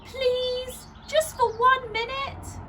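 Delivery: put-on high, wheedling little boy; pleading child. A narrator's reading. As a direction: beg like a small child. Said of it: "sighed Missus Large".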